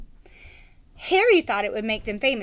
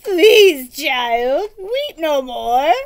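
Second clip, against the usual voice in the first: louder, slow begging plea; begging knight; 3 semitones higher, 9 dB louder, much slower than usual. Acted. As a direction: beg her to stop, drawn out. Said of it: "Sir Fartsalot begged".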